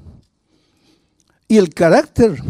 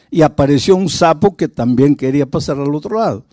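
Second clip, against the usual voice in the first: normal talking voice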